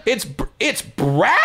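high, slow, strained double-take; baffled realization. Retold or imitated. Audience realizing who was behind the puppet, incredulous. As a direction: drag out the confused question. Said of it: "We're like".

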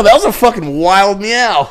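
usual speaking voice

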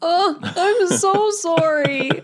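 high, slow wail of apology; high-pitched, long-drawn sorry. Real, not acted. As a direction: squeal the apology, stretching the words. Player reacts to goblin exploding.

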